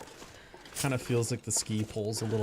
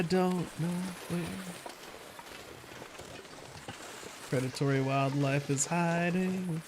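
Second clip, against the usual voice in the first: spooky storytelling; 7 semitones higher than usual. Pretend. Spooky sing-song about the aurora.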